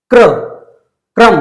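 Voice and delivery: क्रम with no vowel between क and र; क्रम, k joined to r; kr cluster. Shown as a demonstration. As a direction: say क्रम as kram, k and r fused. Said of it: "क के बाद अ की आवाज नहीं आई".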